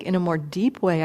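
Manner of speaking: ordinary talk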